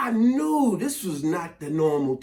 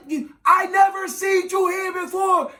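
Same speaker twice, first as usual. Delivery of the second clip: high-pitched, strained club shout; yelling over music; 11 semitones higher than usual. Retold or imitated. Man repeating his pickup line, high mock yell. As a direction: holler each word over thumping music. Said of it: "You gotta yell when you're in a club".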